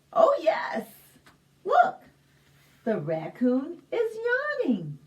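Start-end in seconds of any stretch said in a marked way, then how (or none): 0.1-2.0 high loud delighted cry; bright shout of delight; excited. Acted. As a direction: exclaim with big excitement to the kids. Excited reading to children.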